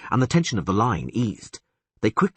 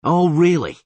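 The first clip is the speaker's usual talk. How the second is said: sarcastic; 9 semitones higher, 7 dB louder than usual. Acted. Geralt sarcastic retort.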